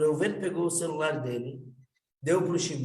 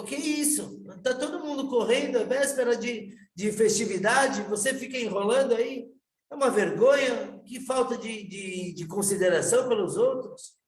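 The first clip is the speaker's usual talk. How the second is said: irritated rebuke; 7 semitones higher than usual. Retold or imitated. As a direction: tell him off for holding up the line. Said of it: "se irritou". (bronca irritada, voz mais alta e mais forte)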